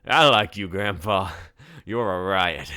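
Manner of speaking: put-on loud, slurred drunken voice; drunk college kid. A narrator's reading. Drunk tourist praising the bartender, loud and slurred. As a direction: drunk and loud, too friendly. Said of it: "his words slurred by a long night of drinking".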